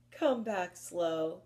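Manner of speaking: drawn-out slow call; slow owl voice. Shown as a demonstration. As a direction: stretch every word slowly. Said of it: "the other named Slow".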